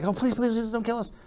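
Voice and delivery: high, hushed, hurried fake pleading; mock frantic panic. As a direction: beg in a put-on panic. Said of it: "like frantically panicking here".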